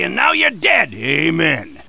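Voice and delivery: ordinary talk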